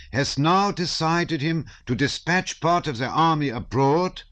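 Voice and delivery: put-on a German accent, recite the manifesto from memory; German officer reciting the manifesto, high and loud. A narrator's reading. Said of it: "repeated from the opening words of the manifesto".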